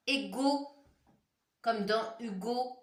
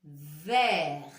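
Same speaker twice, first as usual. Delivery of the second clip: Verre said firmly and lower; reading-along model; 5 semitones lower, 6 dB louder than usual. Shown as a demonstration. Teacher reading aloud with pupils, firm model. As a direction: model the word for the class to repeat. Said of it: "On relit les mots ensemble".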